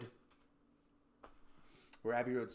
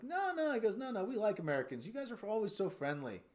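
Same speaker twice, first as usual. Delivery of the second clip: higher-pitched, friendly protest; imitated eager reassurance; 7 semitones higher than usual. British local reassuring an American visitor, imitated eager friendliness.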